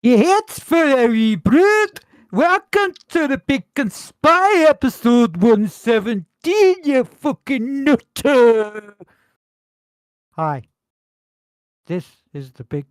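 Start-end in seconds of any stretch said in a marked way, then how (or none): 0.0-8.9 bellowed high and slow, like a town crier; hammed-up show opener. Host welcoming his listeners, hammed-up slow bellow. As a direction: roar the welcome out, stretching every word.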